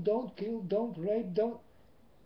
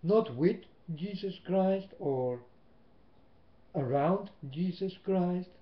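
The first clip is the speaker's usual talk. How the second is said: loud, rising preacher calls on through; full-force preaching; 4 dB louder than usual. Acted. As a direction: hammer it home from the pulpit. Preacher drives the point home.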